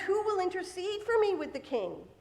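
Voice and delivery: high, pleading read-out cry. A narrator's reading. Losing gladiator appeals to the king, crying out. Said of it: "The other may cry out".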